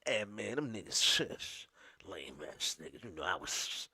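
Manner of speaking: low, soft mock grumble; a hint of a grumbling friend; mock complaint. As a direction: lightly imitate a disgruntled returnee. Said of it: "be like".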